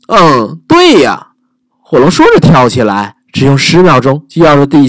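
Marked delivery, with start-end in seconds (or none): 0.1-1.3 proud dragon. Acted.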